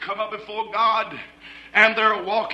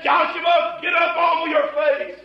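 higher, loud, stern angel's command; commanding shout; 7 semitones higher, 5 dB louder than usual. Acted. Angel of the Lord ordering Joshua to rise, loud commanding shout. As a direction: bark it down from heaven.

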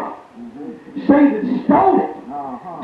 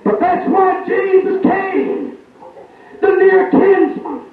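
impassioned preaching; 7 semitones higher, slower than usual. Acted. Preacher crying out to the congregation, high and shouting. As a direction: lift the congregation with a ringing cry.

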